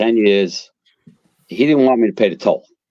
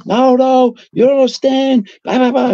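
father shouting; 4 dB louder than usual. Retold or imitated. Father still screaming at son.

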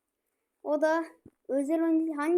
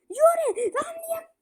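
high shout, a touch less loud; loud urging; 12 semitones higher, 4 dB louder, slower than usual. Real, not acted. Gamer repeating the order to his striker, loud urging. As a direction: keep yelling him forward.

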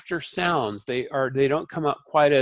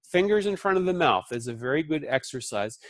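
ordinary delivery

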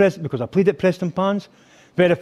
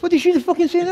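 high, disbelieving question; imitated singer's high-pitched reaction; incredulous outburst; 13 semitones higher, faster than usual. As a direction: squeal it in mock disbelief. Acts the singer's stunned reaction.

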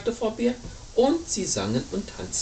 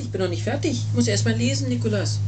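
plain reading voice

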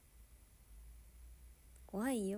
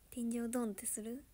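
whisper; 8 dB quieter than usual. Real, not acted. Whispered late-night question.

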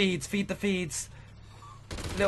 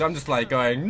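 normal talking voice

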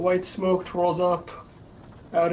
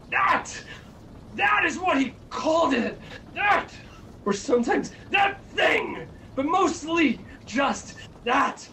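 high-pitched, urgent storytelling; agitated narration; 7 semitones higher than usual. A narrator's reading. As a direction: build the dread with a high, insistent voice. Narrator builds dread of unseen presence.

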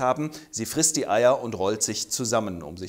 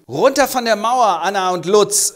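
high, loud police command; mock stern police shout; shouted order; 7 semitones higher, 8 dB louder than usual. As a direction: freeze them with a barked order.

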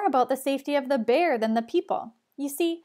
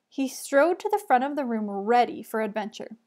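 ordinary delivery, nothing marked

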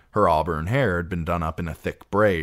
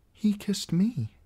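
put-on higher, slow, small girl's voice; a frightened plain truth; a niece answering her aunt; young Sansa; slower than usual.

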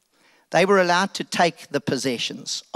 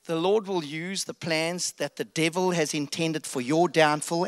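normal talking voice

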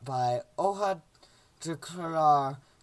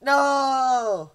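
long high, loud wail; hammed-up drawn-out cry of despair; mock anguish; 7 semitones higher, 8 dB louder than usual. Character losing his limbs, overdone anguished wail.

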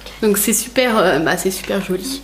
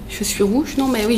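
ordinary talk

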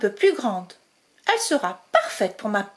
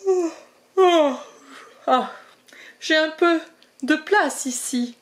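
yawning badger; 5 semitones higher, 3 dB louder than usual. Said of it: "répond le blaireau en bâillant".

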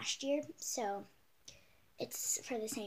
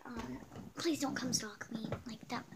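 usual speaking voice

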